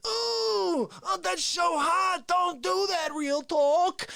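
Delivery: mock pained Face. Face burned by hot coffee.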